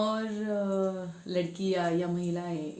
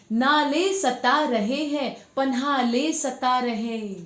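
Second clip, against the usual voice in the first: raised, emphatic verse refrain; poetry recitation; 4 semitones higher, 6 dB louder, slower than usual. Acted. Returning to the poem after explaining it, declaimed refrain. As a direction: declaim the line with drawn-out words.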